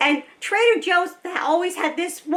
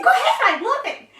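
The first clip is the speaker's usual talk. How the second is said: bright laughing reply, pitched high; giggling yes, go ahead; 8 semitones higher, 3 dB louder than usual. Retold or imitated. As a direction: laugh it out, delighted. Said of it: "I said".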